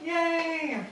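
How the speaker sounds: high, bright little yay; excited cheer. Real, not acted. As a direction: cheer it happily. Cheering before the grocery haul.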